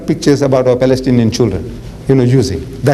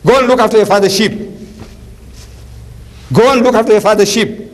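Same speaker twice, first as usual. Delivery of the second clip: scornful commander; 5 semitones higher than usual. Retold or imitated. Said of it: "Saul answers".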